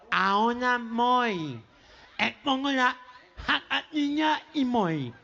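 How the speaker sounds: put-on slow, drawn-out nasal boy; gangoso, nasal twang. Boy repeats himself to mother, slow gangoso voice. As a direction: stretch the vowels through the nose, consonants lost. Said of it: "que era gangoso".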